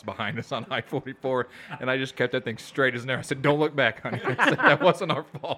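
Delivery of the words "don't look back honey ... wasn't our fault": laughing reassurance. Retold or imitated. Telling wife amid chaos.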